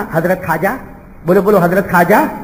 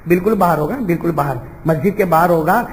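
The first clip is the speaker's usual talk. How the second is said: ordinary talk, nothing marked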